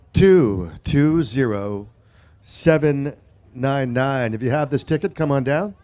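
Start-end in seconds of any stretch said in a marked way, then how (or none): 0.1-4.3 each digit drawn out; slow deliberate repeat. Shown as a demonstration. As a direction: spell out the number slowly. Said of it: "repeat of the number".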